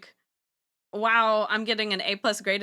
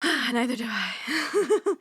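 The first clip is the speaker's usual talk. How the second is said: sighing laugh; 5 semitones higher, much slower than usual. Real, not acted. Laughs at own ignorance.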